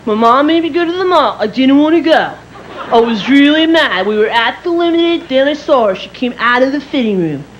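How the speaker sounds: lightly voiced kid telling schoolmates; bragging child. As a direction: brag like a nine-year-old at school. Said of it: "you're bragging".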